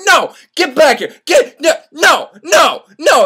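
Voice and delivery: loud, high repeated refusals; commanding shout. Retold or imitated. Soldiers calling deserter back, commanding shout. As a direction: yell each "no" like calling back a runaway.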